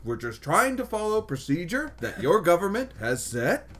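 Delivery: a Canadian border guard losing it, high and loud; stern Canadian accent, laughing through it; angry Canadian accent. Retold or imitated. Guard finally snaps at a complaining traveler, in a Canadian accent, loud and high. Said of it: "I can't do the Canadian accent while angry".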